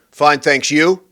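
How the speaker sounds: mock dismissive small talk; get-away-from-me reply. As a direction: toss back the pleasantry to get rid of them. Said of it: "The ultimate "get away from me".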